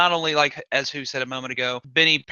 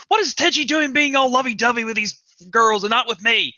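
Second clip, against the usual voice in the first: high, indignant jealous outburst; mock a lovesick woman's loud, pouty complaint; high-pitched whiny impression of jealousy; jealous whine; 8 semitones higher, 4 dB louder than usual. Voices Ryoko's jealous whining.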